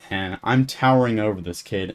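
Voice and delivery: usual speaking voice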